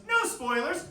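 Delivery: high, loud protest; spoiler protest. Retold or imitated. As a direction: imitate someone blocking out a spoiler. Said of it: "covers his ears".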